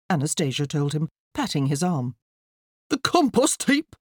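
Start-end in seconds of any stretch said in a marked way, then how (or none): 2.9-3.9 high, dismayed wail; crying out. A narrator's reading. Father horrified at his plant's resting place, crying out. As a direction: cry it out in dismay. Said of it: "Mister McCrumpet cried".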